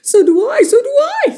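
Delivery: Mister Twit agrees in panic; high panicked whine. Acted.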